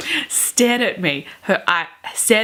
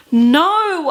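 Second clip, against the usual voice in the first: sudden high loud cry of grief; 10 semitones higher, 6 dB louder than usual. A narrator's reading. Reader voicing Bella's grief at the funeral, loud cry. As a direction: wail it at the graveside. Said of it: "I cried".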